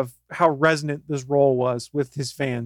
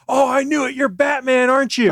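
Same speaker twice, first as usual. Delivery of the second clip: high, louder excited exclamation; excited recognition; 11 semitones higher, 5 dB louder than usual. Retold or imitated. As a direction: act out a thrilled guy spotting a celebrity. Retold excited guy recognizing Batman.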